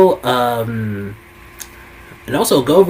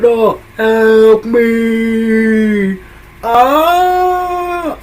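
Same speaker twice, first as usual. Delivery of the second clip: much higher, very slow and drawn-out wail; hammed-up villain crying for help; mock agony; 12 semitones higher, 6 dB louder, much slower than usual. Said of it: "he's going".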